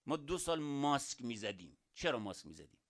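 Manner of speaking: ordinary talk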